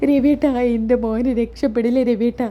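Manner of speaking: acted tearful plea; hammed-up crying appeal; distressed wailing. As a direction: whimper the question as a worried mother. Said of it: "നീ ഇങ്ങനെ വിഷമിക്കാതിരിക്കുക".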